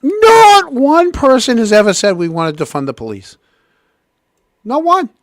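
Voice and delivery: high, loud, incredulous protest; outraged. Real, not acted. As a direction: fire back in real disbelief. Host outraged at clip claim.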